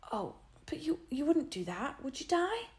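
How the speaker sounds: put-on boy pleading fearfully with his sister; scared pleading. A narrator's reading. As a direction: beg her not to tell.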